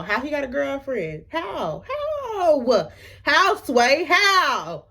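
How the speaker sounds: high, drawn-out comic incredulity; hammed-up bewildered wailing; exaggerated disbelief. Acts out escalating disbelief at news.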